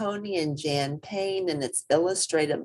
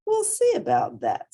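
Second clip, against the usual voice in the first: stubborn; 4 semitones higher, 4 dB louder than usual. Acted. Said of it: "said the little hippo".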